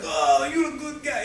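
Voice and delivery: put-on Russian accent, warm drunk praise. Drinking buddies trading praise, in a Russian accent. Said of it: "I do a, a neat accent".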